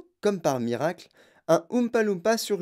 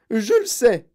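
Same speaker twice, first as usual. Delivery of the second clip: plus aigu et fort; rugi; 7 semitones higher, 7 dB louder, slower than usual. Acted. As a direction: rugir. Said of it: "rugit madame Gloop". (Mme Gloop rugit à Wonka, aigu et fort)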